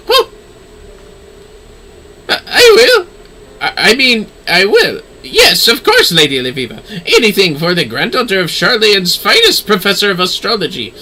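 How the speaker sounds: stammering manservant. Acted. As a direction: scramble to agree with the lady. Manservant stammering, pitch jump.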